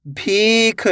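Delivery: भीख with the ee stretched long; भीख, held long for learners; drawn-out long vowel. Shown as a demonstration. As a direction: pull out the ee in भीख. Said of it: "तो आपको खींच के पढ़ना है".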